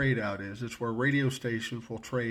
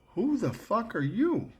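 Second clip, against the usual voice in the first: high-pitched startled outburst, slower; shocked yell; 11 semitones higher, slower than usual. Retold or imitated. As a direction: jump out of your skin at the stranger. Waking to stranger in room.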